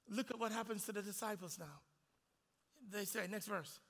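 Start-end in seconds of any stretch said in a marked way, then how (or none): none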